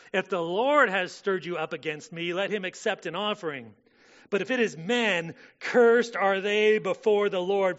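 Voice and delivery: loud, high impassioned preaching read; forceful scripture reading. Acted. Preacher reading David pleading with Saul, loud and impassioned. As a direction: plead the passage at full force.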